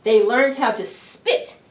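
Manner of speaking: expressive picture book reading; reading to children. A narrator's reading. Librarian reads a monster story to children. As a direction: read it big and lively for little ones.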